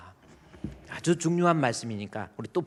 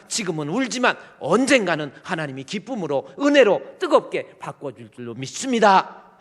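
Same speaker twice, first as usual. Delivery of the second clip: building comfort; 5 semitones higher, 9 dB louder than usual. Acted. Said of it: "목사님이 때로는 말씀을 통해서".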